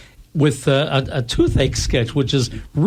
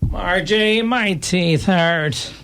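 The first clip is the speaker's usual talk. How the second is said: high, slow moaning complaint; whiny husband; 4 semitones higher, much slower than usual. Acted. Husband whines to his wife about his aching teeth. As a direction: drag it out like a sulking patient.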